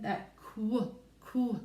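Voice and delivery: qu said alone, clipped off; isolating qu. Shown as a demonstration. Teacher isolating the opening blend. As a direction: give just the qu sound by itself. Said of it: "I hear a blend".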